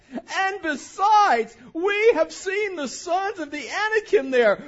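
slow, high, loud crying whine; whiny voice. Pretend. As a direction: drag out besides in a tearful wail. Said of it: "I'll do a little whiny voice".

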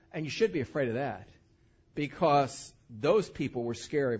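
usual speaking voice

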